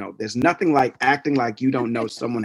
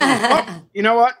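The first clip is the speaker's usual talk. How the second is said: mock realization; 9 semitones higher, 5 dB louder than usual. Realizing the name was wrong.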